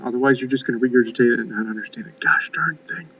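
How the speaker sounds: breathy whispered aside to the class. Real, not acted. Teacher whispering a warning to students about homework, whispered. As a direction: drop to a whisper, like sharing a secret.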